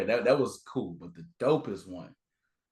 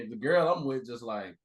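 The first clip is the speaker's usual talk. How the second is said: ordinary talk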